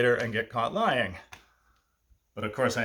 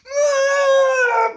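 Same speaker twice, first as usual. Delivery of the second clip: much higher, loud crying wail; imitated baby wail; 10 dB louder than usual. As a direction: howl like a bawling baby. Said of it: "breaks down and starts crying like a baby".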